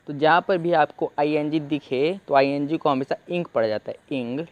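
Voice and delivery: usual speaking voice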